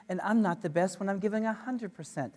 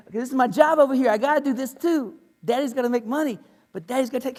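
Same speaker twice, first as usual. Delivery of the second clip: frazzled dad; 6 semitones higher, 8 dB louder, faster than usual. Retold or imitated. Voicing juggling job and baby.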